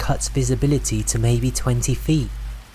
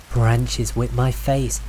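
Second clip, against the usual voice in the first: usual speaking voice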